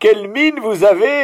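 high, loud, slow shout of greeting. Real, not acted. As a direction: yell it across the square, overjoyed. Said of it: "Je crie comme ça".

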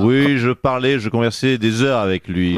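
low, put-on politician voice, hammed-up heartbreak; low mock-wounded lament; mock jilted politician. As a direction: play the betrayed boss in a low caricature voice. Same impersonated politician continues.

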